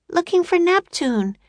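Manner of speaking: high-pitched, lightly projected shout; Annie shouting. A narrator's reading. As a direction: call it back in a high voice. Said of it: "shouted Annie".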